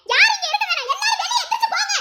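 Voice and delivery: high, fast furious dismissal; angry order out. Acted. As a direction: clear the room in rage. Ordering everyone out of house.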